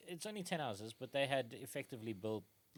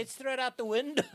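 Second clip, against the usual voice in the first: higher, louder dim-witted puzzlement; baffled medieval folk; 10 semitones higher, 9 dB louder than usual. Retold or imitated. Post-Roman people confused by their waste, mock bafflement. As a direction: play it like clueless peasants. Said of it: "just everyone's like".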